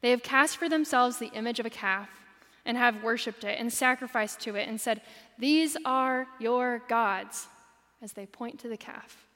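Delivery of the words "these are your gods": slow proclamation. Acted. Quoting the Israelites' proclamation.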